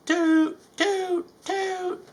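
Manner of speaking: very high sung toots, fading; train whistle toots. Acted. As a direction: toot like a steam whistle. Thomas the engine tooting.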